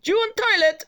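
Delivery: put-on very high Eastern European accent; Eastern European woman. Cleaner offering the toilet, high imitated Eastern European accent. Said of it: "Eastern European woman looked at me and went".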